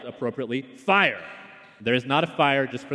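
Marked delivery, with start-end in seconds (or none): 0.6-1.2 yelled warning. Retold or imitated. Pentecostal at a church gathering, loud alarm. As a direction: call out the alarm. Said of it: "a Pentecostal yelled".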